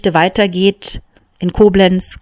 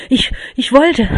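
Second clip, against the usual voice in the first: higher, breathless and stammering after being choked; gasping for air; 6 semitones higher than usual. A narrator's reading. The maid, just released from his grip, gasping for air. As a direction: catch your breath between the words, panicked. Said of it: "keuchte sie nach Luft dringend".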